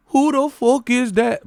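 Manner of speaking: high mock Irish accent; Conor McGregor impression. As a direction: parody an Irish brogue in a high voice. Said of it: "Talking like him".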